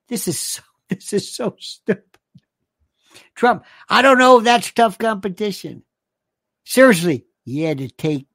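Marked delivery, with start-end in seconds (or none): 3.9-5.8 loud mock Southern accent; broad put-on Southern delivery; Southern affectation. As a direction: blurt it in a hammed-up Southern voice. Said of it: "I get to read it in this voice".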